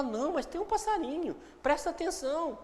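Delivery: acted friend coaxing someone to listen closely; insistent friend. Friend telling someone to pay attention to birdsong, insistent.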